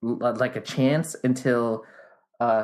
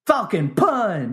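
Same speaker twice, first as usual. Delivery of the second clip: high, slow shouted move name; game announcer shout; 8 semitones higher, 3 dB louder, much slower than usual. Acted. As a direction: yell it like a fighter.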